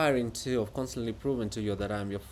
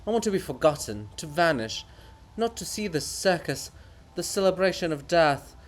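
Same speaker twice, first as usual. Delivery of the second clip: a hint of the pompous writer; lightly voiced weary poet; 4 semitones higher, 3 dB louder than usual. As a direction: declaim your own sacrifice. Bulgakov's grand speech.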